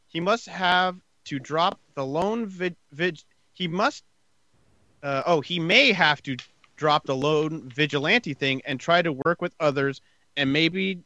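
normal talking voice

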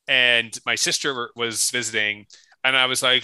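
ordinary talk

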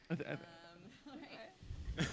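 put-on very slow, soft toddler voice; baby talk. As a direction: drag it out like a toddler. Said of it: "Like baby talk".

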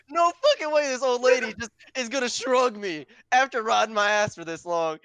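road rage. Retold or imitated. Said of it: "I went through the roof".

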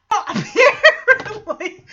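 loud, high word through laughter; real laugh breaking into the word. Real, not acted. Recapper amused by the stagehand's cue, laughing. Cracking up while retelling.

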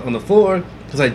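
ordinary talk

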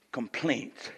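ordinary talk

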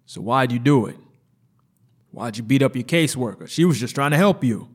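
loud, fast accusing doctor; accusing interrogation. Acted. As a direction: grill her harshly.

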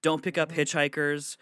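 put-on high, stern elderly warning; grandma's warnings. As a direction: warn like a worried grandma. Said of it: "The warnings she would give me".

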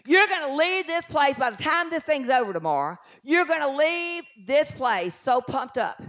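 ordinary talk

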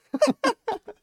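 loud, high cackle; high burst of laughter. Real, not acted. Laughs at the Canada line.

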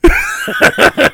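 high, loud laughing yeah yeah; drawn-out mocking yeahs; hammed-up sarcastic agreement. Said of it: "I'm like".

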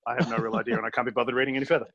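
ordinary talk, nothing marked

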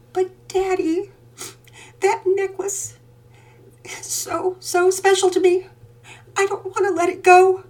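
put-on high, slow little-girl plea; pleading little girl. A narrator's reading. Little daughter pleading with her father not to give up. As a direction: beg Daddy to keep the necklace. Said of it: "she pleaded".

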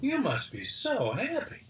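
gently teasing wife, lifted high and slow; wife's voice. Retold or imitated. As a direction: coax him like a wise wife. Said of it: "And she goes".

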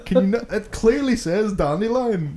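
high, loud laughing disbelief; mock outrage. Someone correcting the audiobook reader, high laughing outrage. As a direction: protest incredulously through laughter.

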